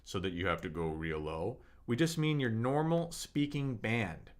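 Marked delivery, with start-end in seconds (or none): 0.0-1.6 forced low voice dropping far down into "low"; strained low voice. Shown as a demonstration. Teacher demos the wrong low pitch to viewers, strained and deep. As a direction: push the voice down to show the mistake. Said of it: "stressing out your voice".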